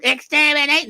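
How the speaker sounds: kids mimicking a high robot screech; Dalek impression. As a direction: shriek it like a playground alien robot. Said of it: "playing like Daleks".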